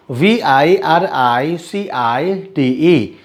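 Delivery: letters spelled slowly, one by one; slow spelling out. Shown as a demonstration. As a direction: dictate each letter slowly for the class. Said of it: "मैं सिर्फ spellings पढ़ूंगा".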